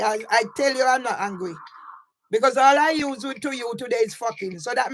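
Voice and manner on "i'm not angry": normal talking voice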